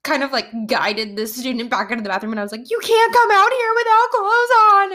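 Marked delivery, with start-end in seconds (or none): 2.7-4.9 high, loud frantic scolding; retold screaming. Teacher guiding student back into bathroom, high frantic yell. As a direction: cry it out high in mock panic. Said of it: "I was screaming".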